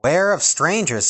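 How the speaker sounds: high, loud dwarf voice; dwarf warning. Acted. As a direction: warn her firmly. Doc warns.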